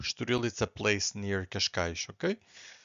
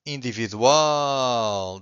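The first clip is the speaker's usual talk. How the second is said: "individual" with a long, loud "al"; stress on final syllable; 5 dB louder, much slower than usual. Shown as a demonstration. Teacher giving another L word, final syllable of "individual" held and stressed. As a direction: stretch the ending of "individual" right out. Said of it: "so we stress the final syllable".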